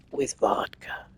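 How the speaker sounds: imitating a sly whisper at the table; conspiratorial whisper. A guest slyly offers a spiked drink, whispered. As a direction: lean in and breathe it like a secret. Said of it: "leans conspiratorially over and says".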